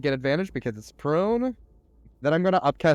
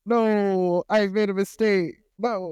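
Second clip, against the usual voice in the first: dismayed wail; 7 semitones higher, slower than usual. Real, not acted. Player regrets the move just made.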